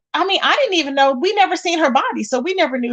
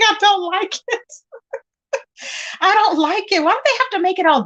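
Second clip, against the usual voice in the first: higher, laughing "I don't like it"; laughing complaint; 6 semitones higher than usual. Real, not acted. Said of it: "[name]'s laughing".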